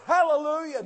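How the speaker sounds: high, loud joyful shout; joyful hallelujah. Retold or imitated. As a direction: cry out in praise. Preacher rejoices at conversion.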